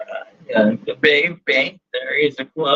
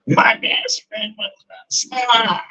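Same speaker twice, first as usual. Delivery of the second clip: receptionist voice; 8 semitones higher than usual. Acted. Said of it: "sound like the receptionist from Monsters, Inc".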